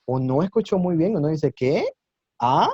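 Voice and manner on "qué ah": "¿Qué? ¿Ah?" sliding up sharply; puzzled, didn't-hear "¿Qué? ¿Ah?", tune climbing; exaggerated questioning rise. Shown as a demonstration. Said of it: "exagerando un poco el tono".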